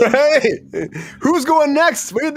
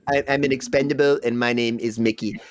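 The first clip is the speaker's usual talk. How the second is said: odd Mickey voice; 7 semitones lower, 4 dB quieter than usual. Retold or imitated. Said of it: "your voices are getting a little weird".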